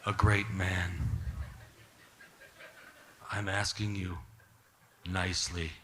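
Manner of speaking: low, slow mobster-style warning; imitated intimidating request, deliberately paced; quiet menace. Imitating an actor's mob-style threat.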